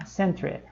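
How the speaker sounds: Century softly repeated as "sen-tre"; British spelling pronunciation. Shown as a demonstration. Teacher repeating the British form for learners, Century as a soft "sen-tre". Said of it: "também muda um pouquinho a pronúncia".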